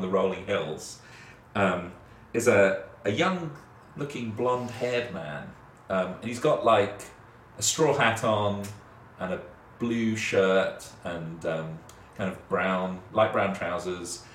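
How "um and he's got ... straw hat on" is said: usual speaking voice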